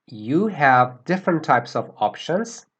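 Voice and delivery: normal talking voice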